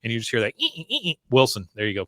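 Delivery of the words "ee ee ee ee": high squeak of a cloth on glass; squeaky window wiping. Retold or imitated. Host imitates the cloth squeaking on the windows, high.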